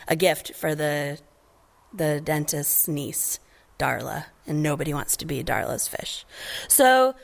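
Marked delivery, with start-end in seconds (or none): none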